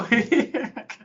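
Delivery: high laugh; burst of laughter. Real, not acted. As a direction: laugh at the slip. Laughing at name slip.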